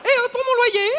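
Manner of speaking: high, loud nudge; insistent prompt. Acted. Landlady bringing talk back to her rent, insistent. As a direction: call out pointedly for an answer.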